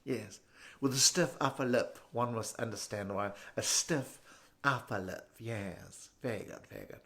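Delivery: low, quiet posh British voice; stiff-upper-lip British. Acted. Snooty type at the bank, stiff posh British. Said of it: "they talk like that".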